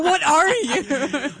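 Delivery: high, loud, drawn-out through laughter; mock freaked-out question. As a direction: stretch it out in hammed-up bewilderment. Acts freak-out at tan British kid.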